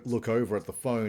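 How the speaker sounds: usual speaking voice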